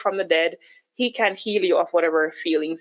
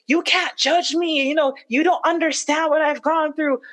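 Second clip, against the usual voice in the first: mock defensive husband; 8 semitones higher than usual. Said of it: "cursing me out".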